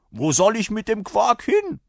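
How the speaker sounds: loud, high-pitched indignant question; scornful rider. A narrator's reading. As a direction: protest loudly at the useless offer. Rider rejects the bottle. (empörter Reiter, laut und hoch)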